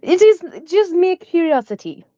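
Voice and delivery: smooth things over, unbothered; lightly voiced calm reply. Character tells the duck it's just curiosity, lightly soothing. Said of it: "and just be like".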